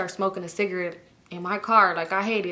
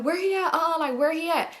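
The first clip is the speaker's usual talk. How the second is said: high, pressing question, much higher; insistent Toya; 8 semitones higher than usual. Retold or imitated. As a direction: push for an answer like a suspicious girlfriend. Toya insisting about Vaughn.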